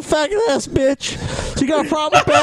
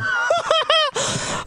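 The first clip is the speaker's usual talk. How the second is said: wild laugh. Real, not acted. Chaos during drinking game.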